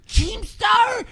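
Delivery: high, shrieking mock call of the name; mock yelling troll. Keemstar imitating a troll yelling his name. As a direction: screech the name like a raging troll. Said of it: "He goes".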